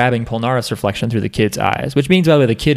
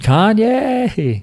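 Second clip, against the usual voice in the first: mock outrage; 10 semitones higher than usual. Echoing [name] with mock outrage.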